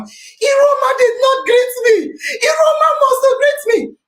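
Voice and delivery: high, whiny mock complaint; mocking imitation. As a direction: parody him wailing about the snub.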